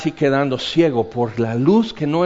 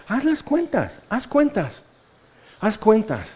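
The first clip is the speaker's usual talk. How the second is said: urging count; 7 semitones higher than usual. Acted.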